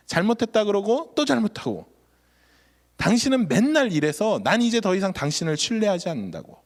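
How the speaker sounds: wife lashing out at her husband, angry and accusing; retold angry outburst. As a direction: pour out the accusations like a wife at the end of her rope. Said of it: "그렇게 막 퍼부으시더래요".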